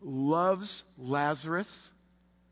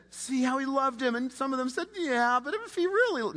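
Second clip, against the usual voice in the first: high mocking crowd; 10 semitones higher than usual.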